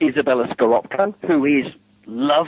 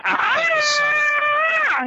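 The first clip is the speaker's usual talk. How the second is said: sudden high yelp at the noise; mock startled cry; mock shout. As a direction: yelp as if blasted by music. Mimics loud music shock.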